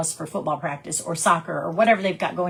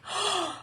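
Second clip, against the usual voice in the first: sharp gasp; 12 semitones higher, 6 dB quieter than usual. Retold or imitated. Caught out, gasps.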